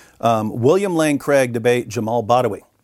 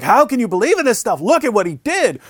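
blasting; 10 semitones higher than usual. Retold or imitated. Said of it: "just start blasting with this stuff".

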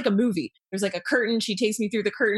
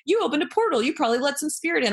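higher, excited imitation of the friend's warning; hammed-up higher alarm, like breaking big news; mock alarmed friend; 8 semitones higher, faster than usual. Retelling her friend's warning about the portal, in a mock alarmed higher voice. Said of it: "it was just really funny because when she goes".

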